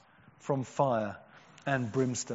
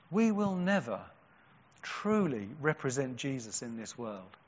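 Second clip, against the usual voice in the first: normal talking voice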